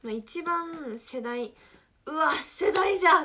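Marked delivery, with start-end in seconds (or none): 2.0-3.2 loud, high cry of recognition; excited exclaim. Retold or imitated. Reacting to a song from her generation with a loud cry. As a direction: blurt it out as if a song just hit you. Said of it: "ってなるのは".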